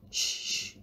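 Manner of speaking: a sharp whispered "Shh"; shushing. A narrator's reading. Professor hushes the pleading visitor with a whispered "Shh". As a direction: hush her at once. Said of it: "he said, waving the cane".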